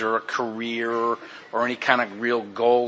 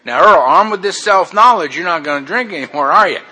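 loud, high mock-stern nurse voice; hammed-up scolding from a nurse; preachy nurse; 6 semitones higher, 9 dB louder than usual. Nurse lecturing the patient: mock-stern, loud and high. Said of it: "she was that close to quoting the book".

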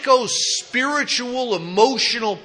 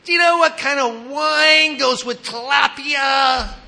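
higher, prissy customer voice, a bit louder; woman at checkout; 7 semitones higher, 5 dB louder than usual. Retold or imitated. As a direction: lightly mimic a fussy shopper asking about wine. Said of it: "She's going".

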